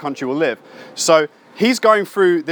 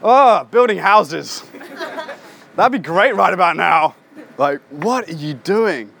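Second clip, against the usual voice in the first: slow, drawn-out ironic musing; mock sarcasm; slower than usual. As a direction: toy with the offer, mock wistful. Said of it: "And Jesus is like".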